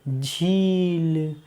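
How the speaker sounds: झील stretched very slowly; drawn-out blending. Shown as a demonstration. Teacher slowly sounding out the blended word. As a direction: draw झील out for the children. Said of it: "तो क्या हो गया?".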